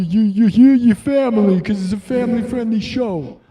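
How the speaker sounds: loud, pushy waiter pitch; mock restaurant commercial; hammed-up ad voice. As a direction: sell the carbs like a manic host. Mock Olive Garden ad voice.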